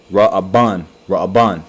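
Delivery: Ra'aban said firmly as rah-bahn, twice; Hebrew pronunciation. Shown as a demonstration. Teacher repeating the Hebrew name Ra'aban for students. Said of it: "pronounced it in the Hebrew".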